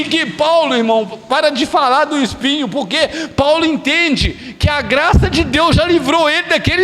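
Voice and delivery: loud, high, rising revival preaching; climbing from loud preaching to near-shouted exultation; full-force preaching. Acted. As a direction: preach it out with growing fire, shouting hallelujah.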